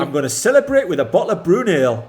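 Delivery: put-on a light, amateur Geordie accent; a son asks his dad for a Geordie accent, mock Geordie lad. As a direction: put on a hammed-up Geordie lad's voice. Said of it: "you'd do your Geordie accent".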